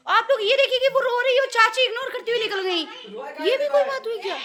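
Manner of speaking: dramatic fake outrage about poor Pari; mock outrage. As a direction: overplay the shock for the camera. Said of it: "अच्छे वाले में ज़्यादा नौटंकी नहीं".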